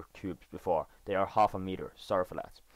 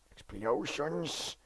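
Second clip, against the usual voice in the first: slow, stretched-out cheer of delight; drawn-out delight; 5 semitones higher, 4 dB quieter, much slower than usual. Real, not acted. Gamer cheering explosions in his game, slow delighted cry.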